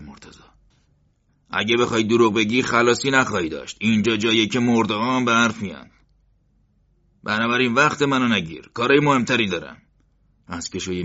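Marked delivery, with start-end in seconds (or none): 1.5-9.8 loud, higher stern threat; menacing threat. A narrator's reading. Interrogator warning Morteza not to lie, loud menacing. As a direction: lean in and threaten.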